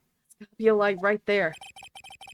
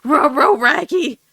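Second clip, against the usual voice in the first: high, loud cartoon dog voice; Scooby-Doo voice; 5 semitones higher, 7 dB louder than usual. Shown as a demonstration. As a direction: growl it like Scooby. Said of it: "the closest I can do ... Scooby".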